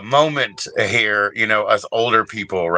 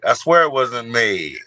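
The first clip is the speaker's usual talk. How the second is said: ordinary talk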